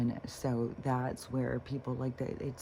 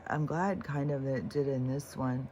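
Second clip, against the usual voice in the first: usual speaking voice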